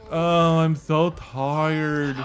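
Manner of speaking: slow, drawn-out tired whine; groggy teen voice. Retold or imitated. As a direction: drag it out like a sleepy teen. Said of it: "be like".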